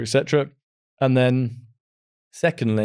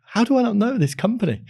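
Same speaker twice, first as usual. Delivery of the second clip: higher-pitched mock-astonished self-quote; retold disbelief; 9 semitones higher, faster than usual. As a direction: act out baffled surprise at yourself. Retells own surprise at unknown company.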